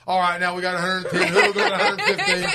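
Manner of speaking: high laughing mock auctioneer call. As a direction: squeal the next bid out, laughing. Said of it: "he goes".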